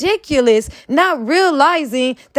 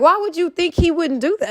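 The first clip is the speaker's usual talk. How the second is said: ordinary delivery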